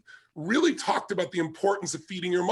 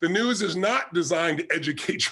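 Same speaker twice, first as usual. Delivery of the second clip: ordinary delivery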